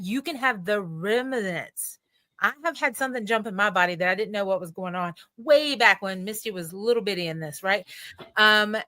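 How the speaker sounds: normal talking voice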